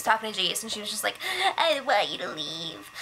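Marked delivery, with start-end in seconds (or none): 1.6-2.9 low, choked-up imitation of crying; mock tearful plea. Shelly talking to Jason, in a low mock-tearful voice. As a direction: imitate a weepy, lowered voice. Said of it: "easy to cry".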